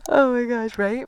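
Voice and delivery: exasperated. Real, not acted. Laughing at her own toddler bit, loud exasperation. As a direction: throw up your hands at yourself.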